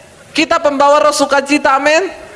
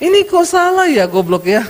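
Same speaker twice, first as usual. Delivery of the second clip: insult while smiling; 4 semitones higher than usual. Acted. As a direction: scold with a smile in the voice. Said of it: "Cuma sambil senyum".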